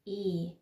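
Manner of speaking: ای held as a long open "eh"; drawn-out ä. Shown as a demonstration. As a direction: stretch the vowel in ای, German ä. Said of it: "باید این رو بیشتر بکشیم".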